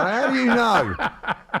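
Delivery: high, slow, laughing disbelief. Real, not acted. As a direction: laugh through the question.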